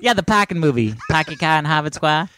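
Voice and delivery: put-on Boston accent. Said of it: "with a Boston accent".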